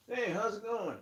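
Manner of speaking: high, light mock greeting; mock casual guy. Mocking people who wear shades on their heads. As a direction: play a goofy casual dude. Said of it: "where they're like".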